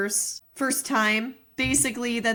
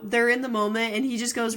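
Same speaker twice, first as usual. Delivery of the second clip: usual speaking voice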